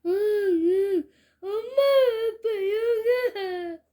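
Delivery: high, loud, slow crying whimpers; a little boy sobbing for his family; sobbing child. A narrator's reading. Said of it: "더 서럽게 울기 시작합니다".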